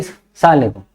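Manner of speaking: सलाम अलैकुम run together as "saalekum"; swallowed greeting. Retold or imitated. Child saluting the teacher, सलाम अलैकुम slurred into "saalekum". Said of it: "अपने अंदर ही कहते".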